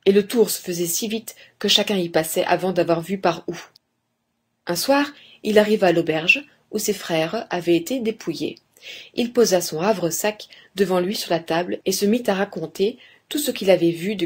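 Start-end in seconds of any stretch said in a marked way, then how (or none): none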